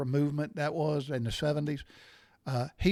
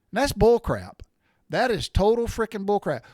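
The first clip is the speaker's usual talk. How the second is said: louder, indignant rant about the bullcrap; angry outburst; 4 semitones higher, 6 dB louder than usual. Real, not acted. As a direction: let the indignation boil over. Ranting about insurance premiums.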